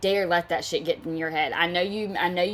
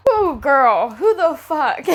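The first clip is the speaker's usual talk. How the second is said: high, loud disbelieving cry; shocked outburst; 7 semitones higher, 6 dB louder, slower than usual. Real, not acted. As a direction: let the shock burst out of you. Outraged reaction to mother's message.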